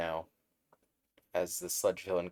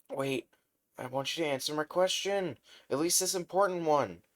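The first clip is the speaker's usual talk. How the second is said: higher, quicker pleading; urgent plea; 9 semitones higher than usual. Acted. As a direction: beg him to wait. Deku begging hero to stay.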